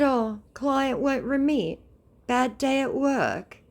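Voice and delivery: high, slow mocking sympathy. Mocking the scammer's bad day, high slow fake pity.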